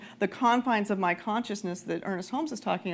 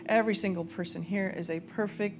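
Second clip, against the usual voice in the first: guided prayer; 2 semitones lower, 4 dB quieter than usual. Acted. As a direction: guide the room into prayer, unhurried. Leads prayer over music.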